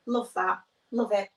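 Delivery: ordinary talk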